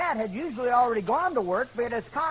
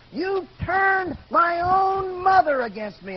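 high, loud, slow furious shout; retold angry scream; 8 semitones higher, 6 dB louder, slower than usual. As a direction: yell the accusation, stretching the words. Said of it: "was cussing him".

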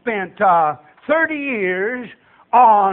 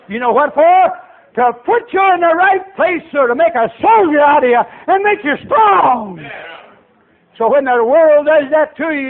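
raised, loud pulpit delivery; impassioned preaching; 5 semitones higher, 6 dB louder than usual. Real, not acted. Preacher tells church of God making soldiers, forceful exhortation. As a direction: preach it with force, building to a cry.

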